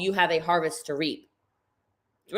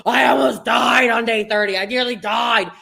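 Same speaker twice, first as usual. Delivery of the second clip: mock complaint; 2 semitones higher, 6 dB louder than usual. People whining at day thirty.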